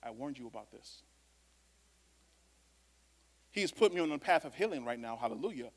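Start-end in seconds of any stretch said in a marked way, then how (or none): 0.0-1.0 low and much softer warning; a quiet told-you-so from God; God's low warning. Retold or imitated. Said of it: "the Lord's just like".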